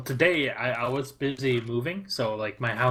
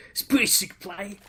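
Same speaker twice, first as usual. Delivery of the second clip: high, rising Australian caster voice; Australian accent; 7 semitones higher than usual. Retold or imitated. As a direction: imitate an Australian commentator, pitched right up. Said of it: "how they actually speak and how their, um, accent".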